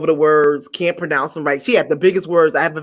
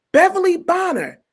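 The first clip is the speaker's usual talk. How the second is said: announcing name; 10 semitones higher than usual. Retold or imitated. Guy announcing her.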